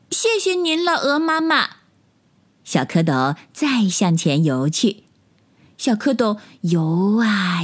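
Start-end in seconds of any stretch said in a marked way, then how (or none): 0.1-1.7 put-on high-pitched childlike thanks; little tadpole. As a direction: thank them in a tiny high voice. Tadpoles thank mother goose. (小蝌蚪稚嫩地高声道谢)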